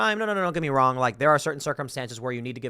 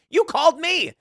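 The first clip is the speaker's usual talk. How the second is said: incredulous retort; 13 semitones higher, 4 dB louder than usual. Retold or imitated. Retorting to scam caller.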